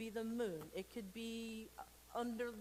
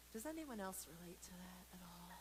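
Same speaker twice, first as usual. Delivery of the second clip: a soft, breathy whispered aside; 8 dB quieter than usual. Real, not acted. Aside to kids during story.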